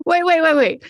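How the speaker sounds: loud, urgent waits; insistent interruption. Real, not acted. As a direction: cut in hard.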